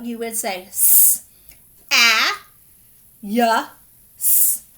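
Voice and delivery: s, a, ya, s sounded out letter by letter; sounding out. Shown as a demonstration. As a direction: break "s, a, ya, s" into separate sounds. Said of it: "If you were going to sound it out".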